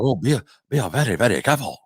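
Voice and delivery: a hint of a put-on Japanese accent; lightly mock the accent he did not do. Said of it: "he didn't do it like that".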